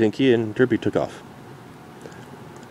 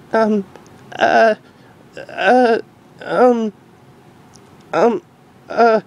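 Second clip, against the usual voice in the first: higher, louder stammered fillers; lost for words; flustered; 13 semitones higher, 3 dB louder, much slower than usual. Acted. Derpy flustered, stalling her daughter. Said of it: "eyes darted more unusually".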